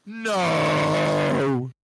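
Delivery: long drawn-out cry of disbelief; astonished disbelief. Real, not acted. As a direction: stretch it out, stunned by the scoop. Astonished reaction to co-host's scoop.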